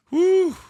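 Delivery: loud high-pitched whoop. Real, not acted. Podcaster closing out a water mystery, high whoop. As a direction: let out a big, high "woo".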